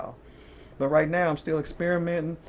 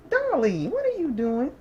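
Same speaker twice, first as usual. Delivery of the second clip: pet talk to the dog; 9 semitones higher than usual. Real, not acted. Tarot reader cooing at her dog Dolly mid-reading, high baby talk. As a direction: coo at the dog like a pet owner.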